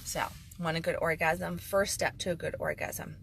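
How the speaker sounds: ordinary talk, nothing marked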